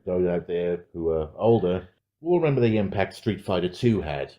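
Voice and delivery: ordinary delivery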